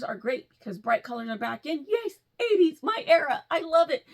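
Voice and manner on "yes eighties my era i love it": high, loud, gushing about the eighties; excited. Real, not acted. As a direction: squeal it happily.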